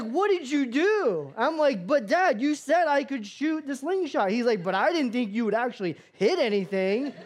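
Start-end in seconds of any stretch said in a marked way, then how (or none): 0.0-1.3 high, loud, drawn-out mock horror; horrified dad. Dad to son after the bird is hit, hammed-up horror. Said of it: "my dad is horrified". 1.9-4.3 high, loud whine of self-defence; protesting kid. Retold or imitated. Ten-year-old to his horrified dad, high-pitched protest. 4.6-7.1 raised, mock-appalled disbelief; horrified dad. Dad answering son's excuse, mock-horrified retort. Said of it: "my dad is horrified".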